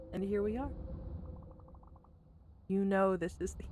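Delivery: quiet, steadied reading of game text; hushed story narration; soft narration reading. Acted. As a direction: gather yourself and read it solemnly. Reads the final narration aloud.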